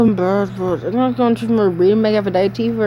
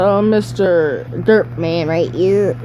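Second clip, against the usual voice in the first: normal talking voice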